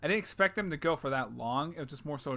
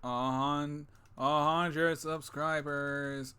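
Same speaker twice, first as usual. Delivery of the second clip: very slow announcement; much slower than usual. Shown as a demonstration. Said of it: "Oh, whoops".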